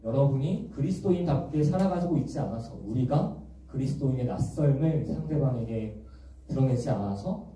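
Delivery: usual speaking voice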